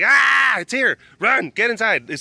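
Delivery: high, loud panicked yell; alarmed shout. Retold or imitated. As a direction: shout the warning to run inside. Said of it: "And I'm like".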